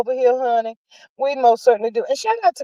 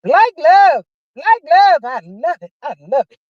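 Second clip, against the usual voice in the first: excited gush; 5 semitones higher, 3 dB louder than usual. Retold or imitated. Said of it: "I said".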